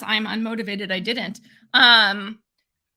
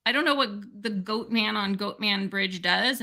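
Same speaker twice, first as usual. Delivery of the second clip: ordinary delivery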